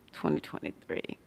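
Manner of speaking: breathy whispered aside; hushed knowing whisper. Real, not acted. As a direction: whisper it like a shared secret. Knowing repeat of the year.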